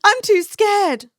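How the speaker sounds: mocking her own fear about posting; mock scared whine. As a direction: whine it like a scared kid, hammed-up. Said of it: "and then I'm like".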